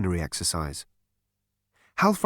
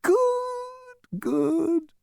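much higher, gleeful sing-song; beaming. A narrator's reading. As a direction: purr with delight. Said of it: "beamed Halfront".

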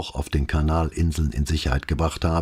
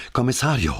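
panting; 6 semitones higher than usual. A narrator's reading. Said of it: "keuchte er".

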